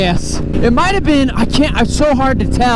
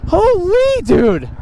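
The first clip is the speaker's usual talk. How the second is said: awed; 9 semitones higher, much slower than usual. Real, not acted. Awed at the view.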